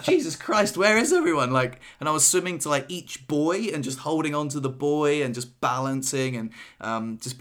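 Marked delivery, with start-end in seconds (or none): 0.0-1.5 higher, louder shocked cry; alarmed at the empty lake; mock dismay.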